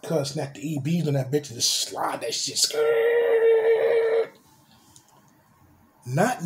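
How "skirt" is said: long, high, loud screeching skirt; tire screech. Retold or imitated. Describing the car sliding past the park, loud tire screech. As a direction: squeal it out like burning rubber.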